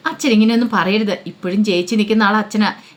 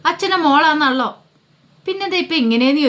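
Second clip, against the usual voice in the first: higher, bewildered asking; puzzled question; 6 semitones higher than usual. Acted. Young girl puzzled about her sister, bewildered. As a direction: ask in honest confusion.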